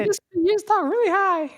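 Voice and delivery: very high falsetto puppet voice; Elmo. Shown as a demonstration. Cohost asks to hear the costume voice, squeaky-high character demo. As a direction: talk really high, like a children's puppet. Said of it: "Did you do a voice?".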